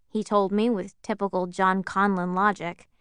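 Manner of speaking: plain reading voice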